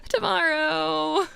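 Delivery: slow, high, drawn-out whimper; mock wailing. Real, not acted. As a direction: stretch it into a hammed-up tearful moan. Whining about coming soreness.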